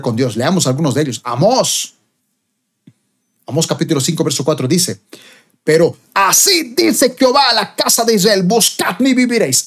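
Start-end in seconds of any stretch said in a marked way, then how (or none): 5.6-9.6 high, ringing declaration of God's word; prophetic scripture proclamation. Acted. Preacher proclaims Amos to listeners, high prophetic declaration.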